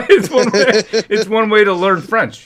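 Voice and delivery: high laughing voice. Real, not acted. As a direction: say it while laughing.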